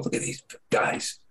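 breathy unvoiced whisper, slowed down. Retelling the video, whispering the movers' line slowly. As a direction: hush it like the movers peeking at the screen.

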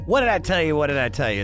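ordinary talk, nothing marked

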